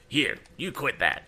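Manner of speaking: Andy ordering Tom to quit; ordered. A narrator's reading. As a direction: snap at him to stop. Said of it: "ordered Andy".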